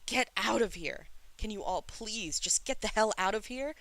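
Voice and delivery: high, loud desperate yelling; screaming rage. A narrator's reading. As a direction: scream at them all to leave. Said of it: "it has him screaming twice".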